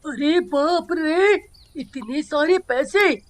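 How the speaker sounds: slow, astonished gasp of words; amazed. Acted. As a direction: stretch it out in disbelief. Finds bag full of money. (हैरानी से धीमे-धीमे)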